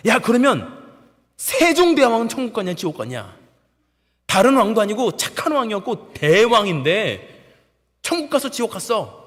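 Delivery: high, loud challenge; heated, insistent interrogation; confrontational senior. Retold or imitated. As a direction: press the recruit for an answer. Senior soldier challenges the recruit.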